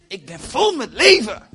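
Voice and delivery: high, loud and full of life; exuberant shout. Real, not acted. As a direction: proclaim it at the top of the voice. Exuberant declaration from the pulpit. (uitbundig en luid uitgeroepen)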